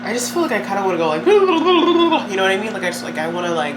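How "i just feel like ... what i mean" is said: giddy laughter breaking into the words; laughing. Real, not acted. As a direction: laugh through it, giddy and fast. Laughs at own restlessness.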